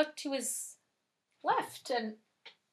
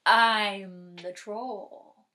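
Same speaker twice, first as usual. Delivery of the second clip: very slow, drawn-out troll; 3 semitones lower, 5 dB louder, much slower than usual. Acted. Troll introducing himself to the little goat, slow and menacing. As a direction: announce yourself as a ponderous troll. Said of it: "said the troll".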